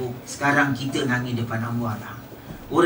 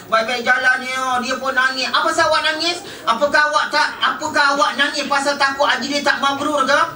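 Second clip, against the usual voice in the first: preaching at full force; 10 semitones higher than usual. Acted. Preacher to the mourners about parting, fiery preaching. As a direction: drive the point home to the hall.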